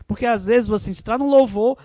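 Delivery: ordinary talk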